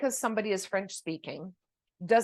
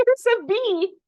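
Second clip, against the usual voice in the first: startled by a bee; 12 semitones higher, 8 dB louder, slower than usual. Real, not acted. A bee flies into the room.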